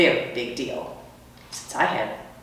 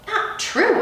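high, quick protest; arguing squeak. Acted. Hamster protests to the custodian in a high squeak. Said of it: "I argued".

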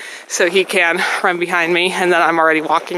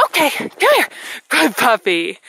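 calling the dog; 5 semitones higher than usual. Real, not acted.

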